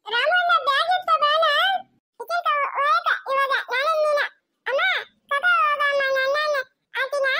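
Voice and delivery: whiny pleading. Acted. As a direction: plead to be taken along. Child begs not to be left.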